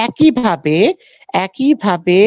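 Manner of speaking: ordinary talk, nothing marked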